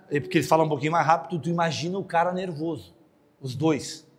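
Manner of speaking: ordinary delivery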